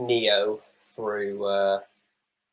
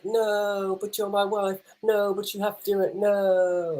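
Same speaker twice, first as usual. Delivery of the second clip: higher, hammed-up anguished protest; mock dramatic plea; 10 semitones higher, slower than usual. Predicting the hero's tearful refusal to his wife, mock anguished plea. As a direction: play the torn husband over the top. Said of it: "And it will be like".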